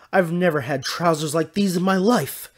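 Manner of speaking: high, loud indignant disbelief; loud astonished denial. A narrator's reading. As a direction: protest it, amazed. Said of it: "Dandelion said in astonishment".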